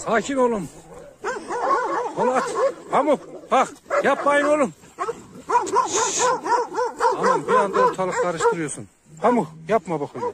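high, loud real yell; shouting at dogs. Real, not acted. Shepherd yelling at his dogs Polat and Pamuk to stop, loud shout. Dogs start fighting the pups.

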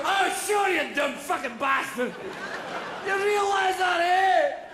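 loud, high Scottish shouting; hammed-up Scottish bellow; drunken Scotsman. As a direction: hurl abuse as a drunk Scot. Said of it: "go drinking with a Scotsman".

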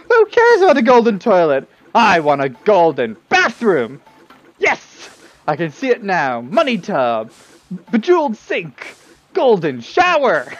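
loud, gushing daydream; greedy excitement. Acted. Lineback raving about his golden bathroom, loud and gushing. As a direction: rave about riches.

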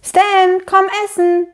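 high, loud sing-out call. A narrator's reading. Mama calling Stan down from the attic to eat, calling out. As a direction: call up to someone at a distance. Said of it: "rief Mama die Dachbodenleiter hoch".

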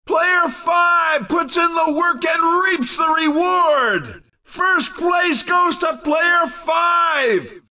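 high-pitched, excited announcer; ringing, excited victory announcement; triumphant winner call. Acted. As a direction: hail a hard-earned win. Announcer calls the race winner.

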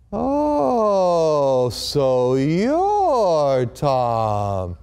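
very slow, drawn-out realization in a changed voice; knowing nurse. Retold or imitated. The nurse realizing who the visitor is, slow and knowing. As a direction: let it dawn on her who he is.

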